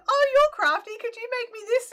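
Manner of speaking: high, loud imitation of an eager asker; big high-pitched mock enthusiasm; gushing request. Voicing people asking crafty favours.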